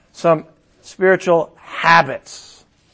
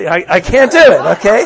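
laughing; 2 semitones higher, 9 dB louder than usual. Real, not acted. Laughs at failed prayer demo.

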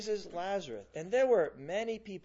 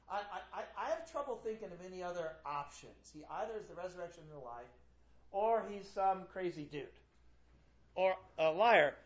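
normal talking voice

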